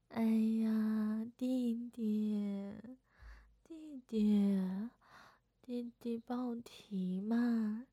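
slow, wheedling plea that drops to a whisper; butter him up, drawn out and cute; coaxing sweet talk. Acted. Sister sweet-talking her little brother into carrying the bags.

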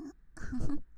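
short loud laugh burst; sudden laugh. Real, not acted. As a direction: break into a quick laugh. Burst of laughter mid-cursing.

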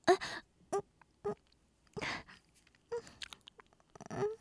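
soft moaning. Acted. Intimate moaning.

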